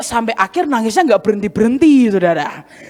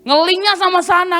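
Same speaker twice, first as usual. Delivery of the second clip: usual speaking voice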